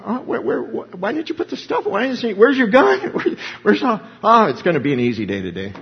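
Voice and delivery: high, anxious mock questioning; worried dad. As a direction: fret at the son over the missing gear. Pastor voices worried dad questioning son.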